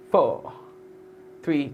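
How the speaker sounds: ordinary talk, nothing marked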